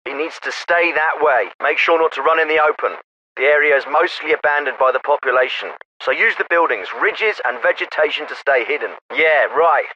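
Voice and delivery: military officer over a field radio; crisp orders through a narrow radio channel; radio transmission. Acted.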